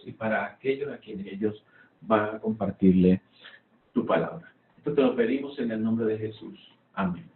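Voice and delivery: earnest spoken prayer. Real, not acted. Opening prayer before class.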